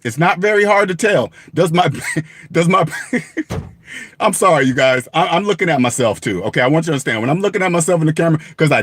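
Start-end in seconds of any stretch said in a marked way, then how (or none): 2.9-3.6 unvoiced helpless laugh at himself; a silent wheezing laugh at himself; breathless laugh. Real, not acted. Viewers watch him crack up at himself. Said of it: "Now I'm laughing at myself".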